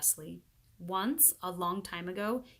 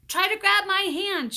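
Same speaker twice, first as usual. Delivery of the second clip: high, loud urgent call; 12 semitones higher, 11 dB louder than usual. A narrator's reading. Leslie calls down to Paul, high and loud. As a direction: reach and shout to him.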